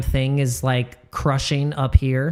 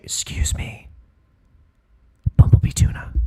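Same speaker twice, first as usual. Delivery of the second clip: unvoiced joke whisper; whispering a joke warning to a friend. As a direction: breathe it secretly, then punch the name.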